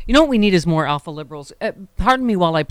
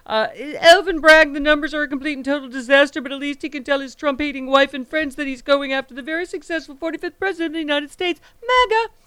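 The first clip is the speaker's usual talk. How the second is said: reading Trump's post aloud in a mocking, pitched-up voice; mocking Trump rant; 6 semitones higher than usual. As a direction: ham up an outraged Trump screed, pitched well up. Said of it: "announcement from the bassinet".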